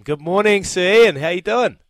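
excited greeting. Real, not acted. Host greets Sir Ian on air.

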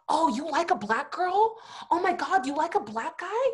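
high-pitched, scandalized schoolkid question; incredulous teasing, pitched well up; mock shock. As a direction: act out classmates gasping over gossip, voice climbing at the end. Classmates' shocked reaction, acted out.

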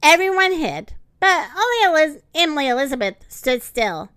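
plain reading voice

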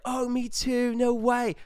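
very high, amazed mock Australian; Australian accent. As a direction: squeal it like a thrilled Australian lad. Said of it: "these kids were also Australian".

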